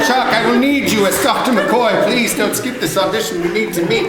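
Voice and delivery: high, loud pleading call; voice from the future. Acted. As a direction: plead urgently as a visitor from the future. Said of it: "To me from the future".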